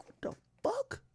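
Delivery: very high, soft disbelief; stunned squeak. Retold or imitated. Sees flamingos from the car, high stunned squeak. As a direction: squeak in shock.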